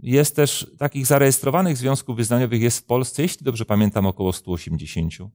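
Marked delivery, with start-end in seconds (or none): none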